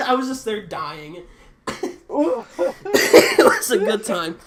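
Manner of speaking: loud, high talk broken by laughs; real laughter bursting through the words; cracking up. Real, not acted. Teller remembering the prank, cracking up.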